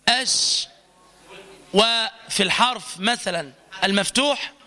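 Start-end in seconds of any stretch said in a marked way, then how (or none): 0.1-0.7 clear, full SH in أش; correct shin. Shown as a demonstration. Teacher models the letter shin for students, correct clear sh. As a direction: say أش with the hushing sh from mid-tongue. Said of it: "فتقول مثلًا".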